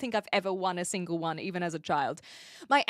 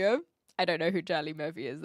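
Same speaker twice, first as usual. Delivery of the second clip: usual speaking voice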